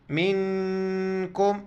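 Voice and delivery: मिनकुम slow, with the n hidden softly in the nose; ikhfa nasal. Shown as a demonstration. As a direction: glide the nun of मिनकुम through the nose, slowly. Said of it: "इख्फा करेंगे, नाक में आवाज़ छुपाएंगे".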